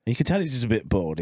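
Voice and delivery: ordinary talk, nothing marked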